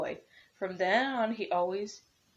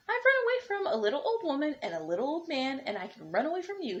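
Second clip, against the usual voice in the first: gingerbread boast; 6 semitones higher, faster than usual. Acted.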